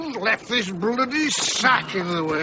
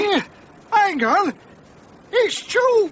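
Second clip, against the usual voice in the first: startled recognition; 9 semitones higher, 3 dB louder than usual. Acted. Recognises man in the sack.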